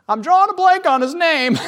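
high, loud blurted admission; panicked confession. Acted. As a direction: blurt the secret thought up high. Voicing the praying man's hidden thought.

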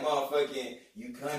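low, quiet mock jab; imitated remark. Muttered put-down, imitating critics. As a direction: other people dismissing him as country, quietly imitated. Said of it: "they'll still be like".

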